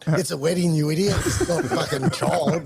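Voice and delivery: mock-scolding wife, loud and exasperated; retold telling-off. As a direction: tear into him like an annoyed wife. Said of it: "my missus has sprayed me".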